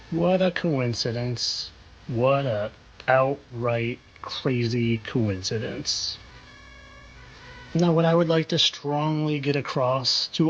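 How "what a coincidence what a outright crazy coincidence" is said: slow, drawn-out irony; sarcastic mock wonder. Host hints to viewers it is no coincidence, slow sarcasm. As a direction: stretch each word with knowing sarcasm. Mock surprise at the supposed match.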